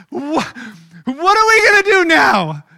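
high, loud, panicky wail; hammed-up fearful servant crying out; panicked servant. Servant panics at the army outside, high panicked wail. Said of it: "he begins to wonder".

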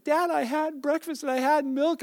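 imitating tearful son. Son in tears insists to dad he had breakfast, mock tearful plea. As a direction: plead like a child insisting on the truth. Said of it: "I see the tears running down his face".

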